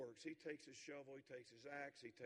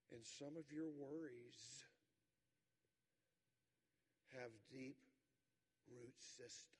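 reflective sermon close; 4 semitones lower, 7 dB quieter than usual. Acted. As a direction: draw the listeners in gently.

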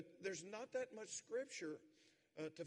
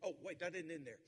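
ordinary talk

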